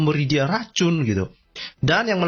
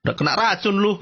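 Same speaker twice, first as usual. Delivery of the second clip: mocking taunt; 6 semitones higher than usual. Mockers jeering at the poisoned prophet, mocking taunt. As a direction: crow it like a teasing bully. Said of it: "diejek-ejek o-, oleh orang Yahudi, bilang".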